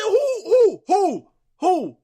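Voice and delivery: hooting disbelief, pitched very high; incredulous who. Retold or imitated. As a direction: keep repeating it, climbing in shock. Hearing of another big loss.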